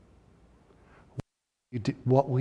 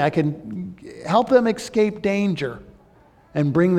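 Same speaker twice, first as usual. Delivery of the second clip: preacher lightly voicing Jesus, raised and earnest; 5 semitones higher, 3 dB louder than usual. Preacher speaking as Jesus to the lost, lightly voiced and raised. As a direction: speak as Jesus promising rescue, lifted and strong. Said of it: "Jesus is saying".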